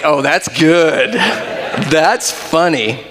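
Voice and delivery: slow, laughing sarcasm; sarcastic slow chuckle; mock amused. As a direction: play it off with a slow fake laugh. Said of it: "And I was like".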